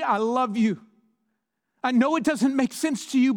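loud, high, impassioned pulpit voice, voicing God's plea; preaching at full force. Preacher booming God's plea to a broken congregation, impassioned. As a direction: drive every line home to the crowd.

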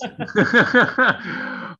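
a bright burst of laughter; real laugh. Real, not acted. Host laughing at his own off-record tip, real laugh. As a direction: laugh it off, caught out.

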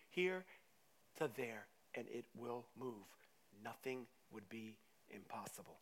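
low, soft, measured statement; quiet assurance. Acted. Jesus telling disciples faith moves mountains, quiet assurance. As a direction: bring it down gently and sincerely. Same verse repeated quietly, earnestly.

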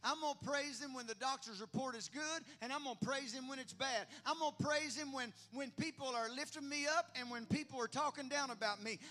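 loud, high, building to a shouted climax; fervent rhythmic declarations, each one bigger; full-force preaching. Real, not acted. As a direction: build the praise into a pulpit roar.

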